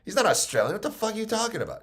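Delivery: high-pitched baffled coach, imitated; lightly played bewilderment, voice jumping up; mock disbelief. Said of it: "He's gonna be like".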